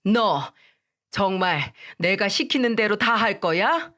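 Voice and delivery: usual speaking voice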